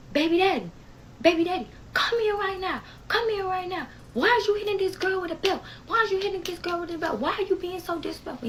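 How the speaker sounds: high, yelling scold at his grown son; imitating an angry grandfather. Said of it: "He was cussing him out".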